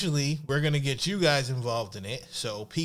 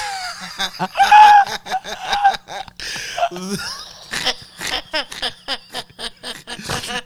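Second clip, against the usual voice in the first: shrieking high laugh; loud laughing fit; 10 dB louder than usual. Real, not acted. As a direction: burst out howling with laughter. Cracking up at own reading.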